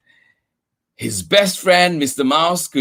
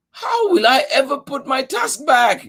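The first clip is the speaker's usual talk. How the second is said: higher mock wail; 11 semitones higher than usual. Ganesha wails over his broken tusk. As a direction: whine it like a crushed little god. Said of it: "Ganesha wailed".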